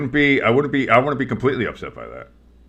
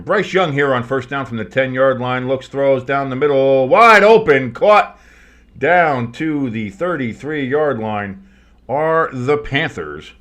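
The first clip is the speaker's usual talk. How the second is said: play-by-play; 3 semitones higher, 5 dB louder, slower than usual. Acted. Live play-by-play call.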